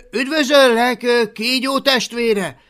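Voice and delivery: high, loud formal greeting; boy hailing a dragon; called out. Acted. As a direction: hail the dragon grandly. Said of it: "ziálta a Hablaty".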